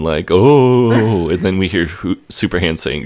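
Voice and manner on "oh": loud, high mock moan; imitated moan. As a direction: moan it out as if from behind a bedroom door. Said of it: "like, a moan".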